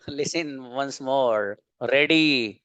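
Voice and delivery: normal talking voice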